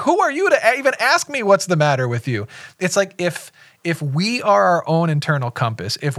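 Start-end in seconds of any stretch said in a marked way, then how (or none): 0.0-2.5 high mock yelling retort. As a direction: fire it back, outraged and high. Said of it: "everybody's yelling at each other".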